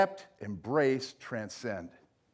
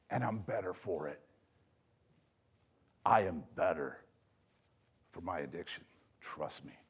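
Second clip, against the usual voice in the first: much softer, lower, intimate; quiet confession; 11 semitones lower, 9 dB quieter than usual. Acted. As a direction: confide it softly, almost to yourself. Quoted voice of embraced recovery.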